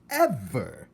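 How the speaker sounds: "ever!" hit loud and falling; stressed ever. Acted. Aunt repeating herself, "ever!" punched loud. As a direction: slam down on "ever!". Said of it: "she said ever again".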